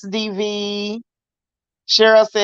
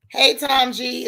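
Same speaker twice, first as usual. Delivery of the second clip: normal talking voice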